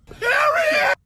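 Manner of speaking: a loud, squealing comeback; shouted put-down. Retold or imitated. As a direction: slam the last word down hard. Final shout at the boy.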